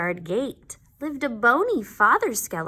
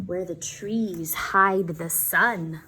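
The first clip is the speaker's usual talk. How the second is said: plain reading voice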